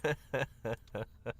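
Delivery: high, loud, through helpless laughter; laughing hard. Real, not acted. Teasing Stacy about her fast reply, laughing hard. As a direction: squeal it out while cracking up.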